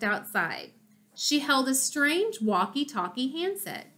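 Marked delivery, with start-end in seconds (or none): none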